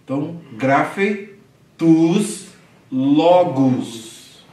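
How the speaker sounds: tus said as tus, with a U, not ou; Greek U sound. Shown as a demonstration. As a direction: stress the U in tus. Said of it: "o som de U".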